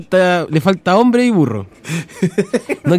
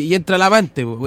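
ordinary talk

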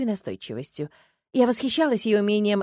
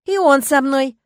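loud, higher defiant retort; 5 semitones higher, 9 dB louder, slower than usual. A narrator's reading. Heroine answering her nosy classmate, loud defiance. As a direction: throw it straight back, defiantly. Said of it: "я упёрла руки в боки".

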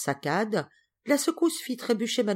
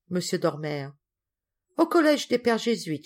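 ordinary delivery, nothing marked

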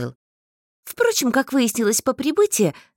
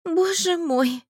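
despairing; 8 semitones higher, slower than usual. A narrator's reading. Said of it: "сказала я".

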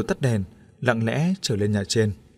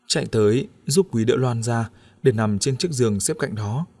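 ordinary delivery

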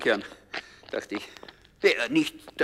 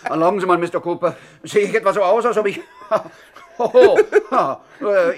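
scared, laughing bluster; frightened little man laughing it off; nervous laugh; 5 semitones higher, 6 dB louder than usual. Acted. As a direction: laugh off the fear. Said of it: "Der kleine Bongo aus Milwaukee hat Angst".

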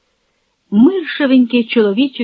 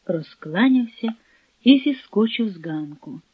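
ordinary delivery

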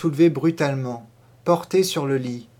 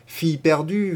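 usual speaking voice

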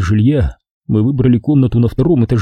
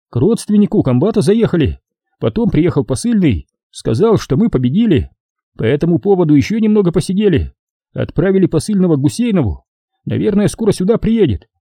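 higher, quick mocking report; soldier comrade voice; 6 semitones higher than usual. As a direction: rattle off the drinking story.

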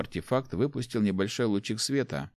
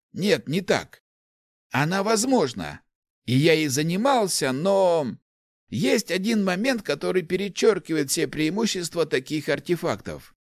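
put-on higher-pitched, animated old man's voice; enthusiastic old inventor; 9 semitones higher than usual. Old inventor explains artefacts to the rector, enthusiastic. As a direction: explain your discovery eagerly, pitched up.

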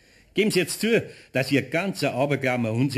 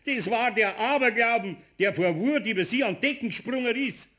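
giggling glee; 7 semitones higher than usual. Acted. Pumuckl laughing at the joke.

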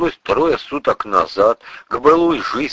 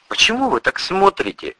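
higher, uneasy burst of a question; unable to hold back; 10 semitones higher than usual. A narrator's reading. Narrator questions the staring priest, uneasy and high. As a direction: blurt it out uneasily. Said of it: "не выдержал я".

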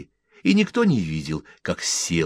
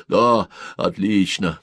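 plain reading voice

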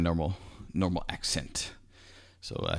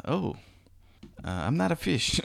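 higher-pitched mock "oh" of dawning realization; higher, lightly acted surprise; imitated sudden realization; 9 semitones higher than usual. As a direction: voice a kid's startled realization, playfully. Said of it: "He realized".

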